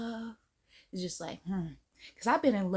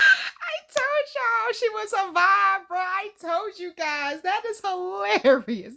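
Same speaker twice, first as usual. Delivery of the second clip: excited; 9 semitones higher, 8 dB louder, slower than usual. Real, not acted. Reacting to the clip.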